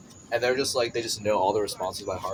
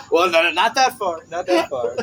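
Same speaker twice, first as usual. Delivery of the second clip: laughing; 7 semitones higher, 5 dB louder than usual. Real, not acted. Laughing correction.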